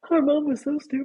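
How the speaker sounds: high-pitched, put-on child's jeer; mocking kid voice. Friend voicing the other's future kids, mocking high voice. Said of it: "your kids can find it and be like".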